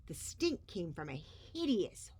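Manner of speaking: ordinary delivery, nothing marked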